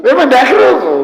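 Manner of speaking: high and loud, fed up that he cannot hear; exasperated shout. Retold or imitated. Son exasperated at deaf father, high frustrated shout. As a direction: blurt it out in frustration.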